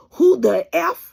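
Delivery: loud, drawn-out challenge to a wrong person; defiant challenge. Pretend. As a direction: throw it back at them, slow and loud. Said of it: "okay, okay".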